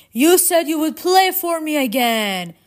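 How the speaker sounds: high, full-voiced shout; shouting after someone. A narrator's reading. Charon calls after departing Orpheus, shouting. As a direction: holler it across the water. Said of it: "Charon shouted after him".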